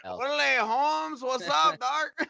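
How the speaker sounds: Chicano gang slang. Retold or imitated. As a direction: play a Chicano vato.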